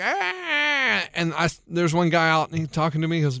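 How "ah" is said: long high whine, falling off; drawn-out soft wail, imitated; imitated kid's fussing. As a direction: mimic a fussy toddler in a wagon. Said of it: "and he's like".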